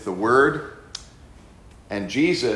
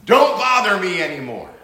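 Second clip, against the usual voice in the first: loud, high, pushy brush-off; the demon telling Jesus to leave him alone, loud and high; demon's rebuff; 6 semitones higher, 9 dB louder than usual. Retold or imitated. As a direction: shove him away with the words.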